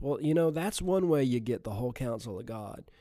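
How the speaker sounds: ordinary delivery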